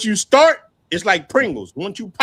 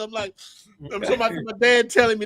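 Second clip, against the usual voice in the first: normal talking voice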